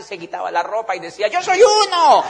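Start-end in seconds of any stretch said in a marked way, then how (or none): 1.3-2.2 very high, loud, frenzied cry; mock crowd shout. Audience member answering the stage, high frenzied shout. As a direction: yell it like a fired-up fan.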